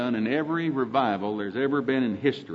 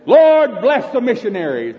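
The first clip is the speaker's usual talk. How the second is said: much higher, louder hammed-up prayer; mock generic prayer; 8 semitones higher, 9 dB louder than usual. Preacher mocks a vague general prayer, hammed-up and loud. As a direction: parody a vague churchy prayer. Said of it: "that big general prayer".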